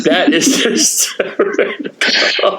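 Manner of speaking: breathless, slow words through laughter; laughing. Real, not acted. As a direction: wheeze it out while laughing. Reacting to the punchline.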